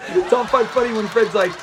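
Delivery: high, giggling through the quote; laughing retelling. Real, not acted. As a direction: tell the joke while cracking up. Laughing while retelling the joke.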